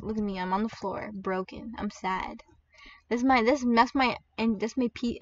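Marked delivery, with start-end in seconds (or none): 0.0-2.4 low, soft, deflated mumble; sad NPC. Pretend. NPC lying broken on the floor, sad and low. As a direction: play a dejected game character, quietly. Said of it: "that's my N P C being sad".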